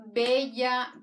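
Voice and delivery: normal talking voice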